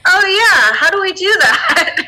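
lightly voiced daughter. Daughter answers her mother's wish to kill Jane, mock-keen. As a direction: play-act a teenager going along with it, high-pitched.

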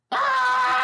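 high, loud goblin shriek; pained scream. Acted. Injured goblin looks at his leg, pained scream. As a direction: shriek at the sight of your leg.

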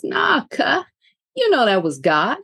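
light slangy little man voice, nah cuh sliding high; lightly voiced cocky little man; little man's slangy voice. Inner voice cutting her off, lightly voiced slangy. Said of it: "little man interrupts me and goes".

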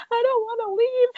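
imitating Monica's teary whine; mock crying. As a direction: whimper it like a sitcom cry. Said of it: "Monica just starts crying".

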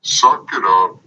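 ordinary talk

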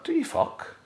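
shot-up pitch of outraged disbelief; incredulous scoff. Retold or imitated. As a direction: scoff at the very idea. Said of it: "I'm like".

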